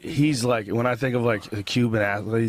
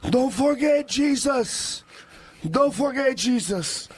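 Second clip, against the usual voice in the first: put-on high, slow thick Cuban accent; 13 semitones higher, slower than usual. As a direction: say it in a heavy Cuban accent, slowly. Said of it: "thick Cuban accent".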